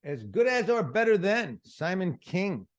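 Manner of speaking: slow, loud title announcement; announcer read. Real, not acted. Host announcing the comedian's special title. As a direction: announce it like a promo. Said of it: "host announcing special title".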